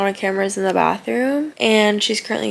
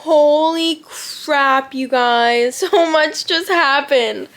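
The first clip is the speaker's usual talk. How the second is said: high, loud, drawn-out astonishment; amazed excitement; 4 semitones higher, 5 dB louder, much slower than usual. Real, not acted. Telling viewers so much just happened, amazed. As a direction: stretch it out, bursting with news.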